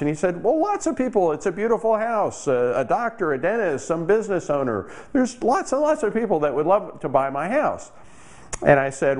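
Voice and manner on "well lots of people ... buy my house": high, indignant protest; defending his beautiful house with a higher pitch; defensive retort. Retold or imitated. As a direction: insist the house is obviously desirable.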